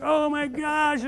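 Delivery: high, slow, breathless oh my gosh; amazed gasp. Retold or imitated. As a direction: stretch it out in sudden wonder. Machine suddenly works, amazed.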